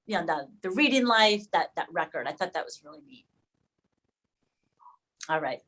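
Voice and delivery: ordinary delivery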